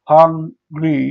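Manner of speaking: Hungry in two separate beats; syllable by syllable. Shown as a demonstration. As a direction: say hang, pause, ri for hungry. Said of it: "syllable অনুযায়ী ভাগ করি".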